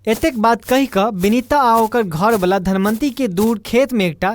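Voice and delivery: plain reading voice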